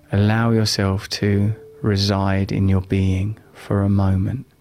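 calm, unhurried guiding voice. Acted. Leads a live guided meditation.

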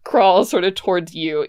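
ordinary talk, nothing marked

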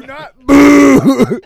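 loud demo of the right boo; shouted demo boo. Shown as a demonstration. Host showing how to boo, loud shout. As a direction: show them how to really boo. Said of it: "You gotta go like this".

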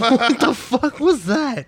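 startled, very high and slow; shocked high squeal. Real, not acted. Startled by flying prop.

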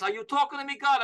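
high-pitched New York challenge; hammed-up tough guy addressing heaven; Robert De Niro. Film star praying to God, mock New York tough-guy voice. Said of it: "he's like this".

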